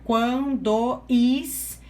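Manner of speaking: "quando is-" stretched very slowly, vowels run together; drawn-out elision. Shown as a demonstration. Teacher showing poetic syllables joined, very slow. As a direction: hold the join of "quando is-" slowly. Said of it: "isso aqui vai ficar junto".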